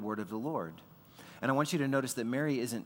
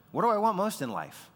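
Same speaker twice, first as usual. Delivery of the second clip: urgent question; 8 semitones higher, 6 dB louder than usual. Real, not acted. Preacher asks congregation.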